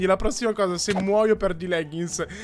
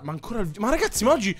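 usual speaking voice

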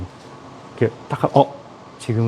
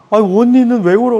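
crying friend; 9 semitones higher, 6 dB louder, faster than usual. Retold or imitated. Crying friend asks back.

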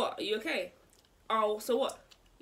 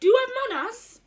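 indignant; 9 semitones higher, 10 dB louder, faster than usual. Real, not acted. Indignant retort.

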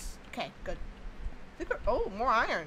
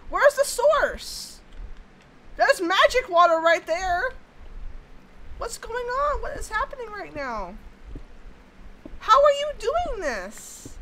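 high, loud exasperated outcry; yelling at the game in disbelief; frustrated exclamations; 9 semitones higher, 5 dB louder than usual. Real, not acted. Frustrated at leaking water.

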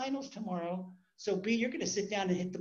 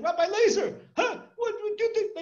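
high, loud frantic cry of alarm; panicked yelling; 14 semitones higher, 7 dB louder than usual. Retold or imitated. Man stops the rabbi on the street, yelling in panic. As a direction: yell it in a panic. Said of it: "and he started yelling".

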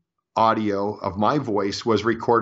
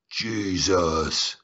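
lightly lowered growl of a demon; a hint of a deep demonic rasp; 3 semitones lower than usual. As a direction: imitate a guttural demon voice saying the name. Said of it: "like that, in a very deep demonic sounding voice".

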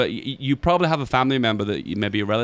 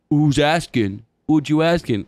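put-on American accent, pitched up a bit; bad American accent; 3 semitones higher, slower than usual. Confronted parker answers back in a bad American accent. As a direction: attempt an American accent, challenging the question. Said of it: "I don't know why I'm doing this accent".

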